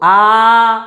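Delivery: "आ" held long; long vowel. Shown as a demonstration. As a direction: draw "आ" right out. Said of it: "लांब उच्चार होतो". ("आ"चा लांब उच्चार)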